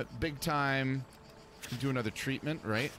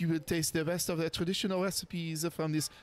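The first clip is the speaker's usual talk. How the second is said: put-on French accent; 8 semitones higher than usual. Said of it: "I do such a great French accent".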